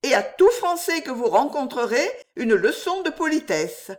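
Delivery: high-pitched, impertinent lecture; mocking, high-pitched hauteur; haughty dismissal. A narrator's reading. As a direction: send him off to learn manners. Jeanne mockingly dismisses the hunter.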